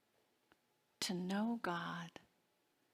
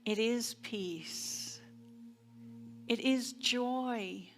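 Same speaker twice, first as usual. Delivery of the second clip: affirmative prayer. Acted. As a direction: lead the congregation inward, gently and unhurried. Leads a spoken affirmative prayer.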